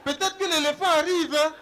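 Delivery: loud, high village-elder voice; mock sage proverb. Mocking the wise elder reciting a proverb. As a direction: declaim it like a bush sage.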